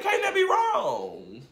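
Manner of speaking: very high, incredulous question; hopeful squeak. Retold or imitated. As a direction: squeak it up in pleading disbelief. Hoping the packaging proves it.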